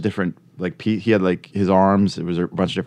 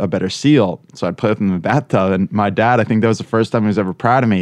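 ordinary talk, nothing marked

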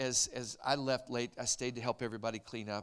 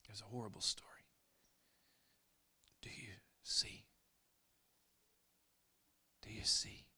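hushed; 6 dB quieter than usual. Real, not acted. Preacher hushes the congregation.

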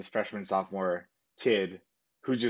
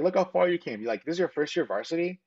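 higher, insistent pep talk; retold coach urging pride; emphatic encouragement; 7 semitones higher than usual. Coach encourages after the loss.

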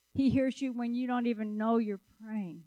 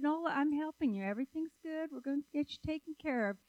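reassuring; 6 semitones higher, faster than usual. Retold or imitated. Helper to resident on the way to the restroom, hurried reassurance.

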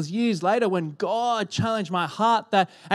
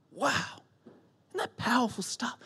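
usual speaking voice

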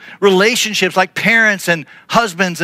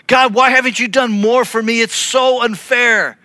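hammed-up self-pitying whine; mock complaining; 7 semitones higher than usual. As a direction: whine each gripe like a sulking child. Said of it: "this is how we respond".

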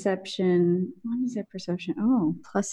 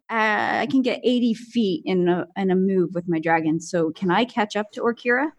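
ordinary talk, nothing marked

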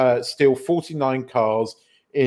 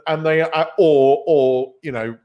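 ordinary delivery

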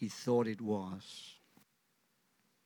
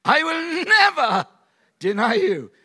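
shouted vow; 14 semitones higher, 13 dB louder than usual. Acted. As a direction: belt the promise out.

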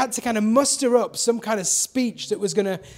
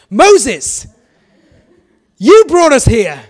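dramatic complaining Israelites; 3 semitones higher, 10 dB louder, slower than usual. Retold or imitated. Israelites blaming Moses, loud and dramatic. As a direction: hurl the blame at him, hammed up. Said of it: "They get very dramatic".

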